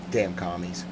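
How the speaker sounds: low, very soft grumble; spent. Real, not acted. Cursing the commies once worn out, spent. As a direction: let it die away under the voice.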